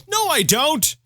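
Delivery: high loud denial; high protest. Real, not acted. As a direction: shout back in protest. Argues with game.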